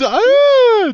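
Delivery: high excited squeal. Real, not acted. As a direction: squeal with delight. Excited about hugging.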